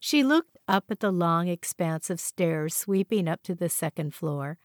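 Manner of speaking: ordinary delivery, nothing marked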